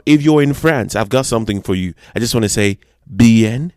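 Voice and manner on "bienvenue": usual speaking voice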